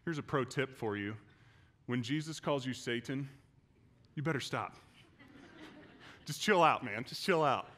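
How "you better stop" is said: ordinary delivery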